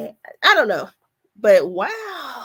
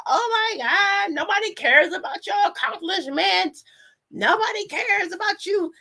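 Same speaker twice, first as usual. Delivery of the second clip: mocking critics; 8 semitones higher than usual. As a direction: imitate the haters with a sneering tone. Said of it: "They was like".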